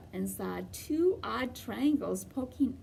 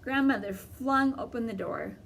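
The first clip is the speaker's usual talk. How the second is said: usual speaking voice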